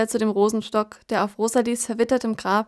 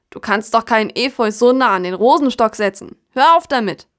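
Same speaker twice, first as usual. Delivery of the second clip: laut und immer lauter schimpfen; wütend schimpfen; 6 dB louder than usual. Acted. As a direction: lauter werdend befehlen aufzuhören. Kasta schimpft drohend. (laut schimpfender Baum)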